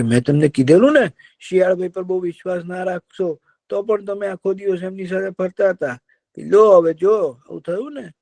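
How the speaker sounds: high, told-you-so crow voice; a wise crow gently scolding. Acted. (ઊંચા અવાજે ઠપકો આપતો કાગડો)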